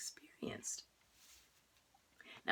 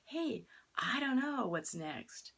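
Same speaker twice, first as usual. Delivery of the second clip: slow, higher, easy wonder; light and curious; 7 semitones higher, slower than usual. Shown as a demonstration. Turning not knowing into an adventure, slow and curious. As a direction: say it openly, no fear, just curiosity. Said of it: "turn it around and say".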